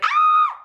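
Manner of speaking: sudden high shriek; startled scream. Retold or imitated. Contestant shrieking as the snake drops. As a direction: yelp in fright. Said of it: "he's like".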